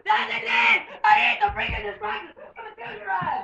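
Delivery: loud high argument; angry shouting. Real, not acted. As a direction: yell back at brother. Arguing with brother.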